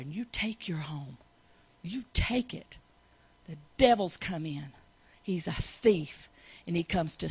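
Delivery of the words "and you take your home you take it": breathy whisper. Real, not acted. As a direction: whisper it urgently. Whispered in sermon.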